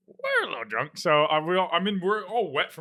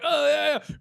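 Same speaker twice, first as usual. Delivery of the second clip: mock party cheer, pitched way up; drunk partygoers; 14 semitones higher, 4 dB louder than usual. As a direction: imitate drunk kids whooping obliviously. Drunk students cheering in the car.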